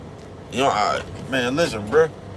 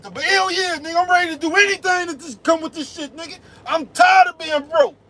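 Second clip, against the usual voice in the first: fired-up nephew yelling. Retold or imitated. Nephew defiantly yelling he's tired of being broke. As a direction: yell it like you're fed up with being broke. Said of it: "His father said".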